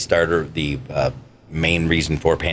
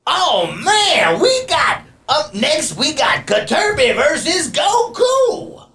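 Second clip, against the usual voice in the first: far higher, slow, shouted announcer voice; alien announcer; slower than usual. Shown as a demonstration. Alien announcer calling Katerbi versus Goku, high and shouted. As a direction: call the next fight like a squeaky cartoon ring announcer. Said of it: "the alien announcer, which, uh, his voice was".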